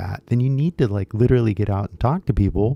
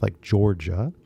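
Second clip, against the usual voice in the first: usual speaking voice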